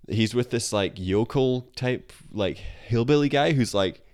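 ordinary talk, nothing marked

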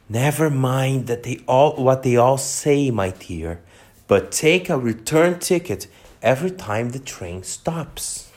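usual speaking voice

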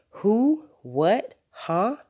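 high-pitched, rising one-word questions; puzzled mother caught off guard; startled questions. A narrator's reading. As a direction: echo the bafflement, each word leaping up. Mother echoes father's startled questions.

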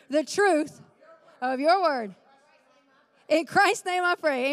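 loud prayer in short, measured phrases; congregational prayer. Acted. Pastor leading the congregation in a declaration before preaching, loud and deliberate prayer. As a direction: pray out boldly, phrase by phrase.

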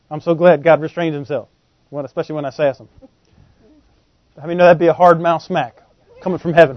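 normal talking voice

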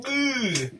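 high, soft groan of distaste; disgusted groan. Retold or imitated. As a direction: recoil with a drawn-out "ugh". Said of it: "I'm just like".

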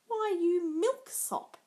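high, loud, slow mocking sneer; jeering. A narrator's reading. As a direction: sneer the insult down at him. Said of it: "jeered Tybalt".

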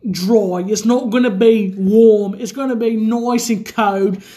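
put-on drawn-out hammy Peaky Blinders British accent; exaggerated British gangster impression. Drinker doing Peaky Blinders impression.

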